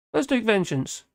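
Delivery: ordinary talk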